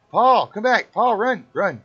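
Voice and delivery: high, loud yell after Paul; shouting. Real, not acted. Yelling at game character.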